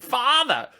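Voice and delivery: put-on high-pitched British movie-child voice; British child actor. Comedian shows how only British movie children sound, British child-actor accent. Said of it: "will sound like this".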